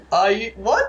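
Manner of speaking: high, disbelieving sputter; incredulous. Retold or imitated. Jennifer Lawrence stunned by a reporter's question, incredulous. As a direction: cut off in shocked disbelief at the question.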